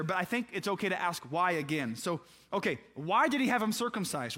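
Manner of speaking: ordinary delivery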